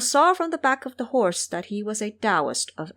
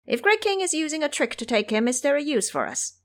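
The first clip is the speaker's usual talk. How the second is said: high, eager little imp; squeaky imp voice; 8 semitones higher, faster than usual. Acted. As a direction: pitch way up like a nervous minion. Imps ask their monster king.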